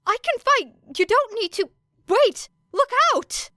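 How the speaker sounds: frantic shouting. A narrator's reading. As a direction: cry out a warning in panic. Boy shouts warning in battle.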